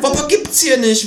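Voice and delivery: put-on high, exasperated teenage cashier. A narrator's reading. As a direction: cashier losing patience, high-pitched. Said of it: "wird der Junge laut".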